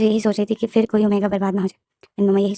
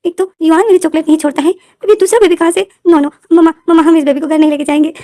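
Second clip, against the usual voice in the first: put-on high, loud stubborn little boy; whiny child; 7 semitones higher, 8 dB louder than usual. Little boy refusing to take the baby home, loud whine. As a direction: refuse like a spoiled kid.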